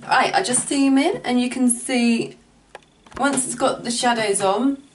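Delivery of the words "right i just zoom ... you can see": ordinary delivery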